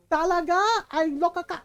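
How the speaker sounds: imitated mom scolding in Tagalog; mock outburst in a Filipino mother's accent; angry Filipino mom. Mom heard from inside cursing in Tagalog, imitated angry scolding. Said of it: "we hear Tagalog".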